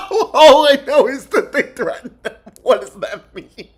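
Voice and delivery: parroting the answer through giggles; laughing mock repeat. Streamer mocks the clip, laughing through it. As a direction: giggle while echoing them.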